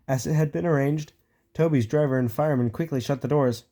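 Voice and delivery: ordinary delivery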